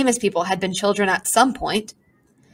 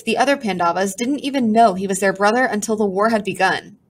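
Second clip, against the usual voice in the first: usual speaking voice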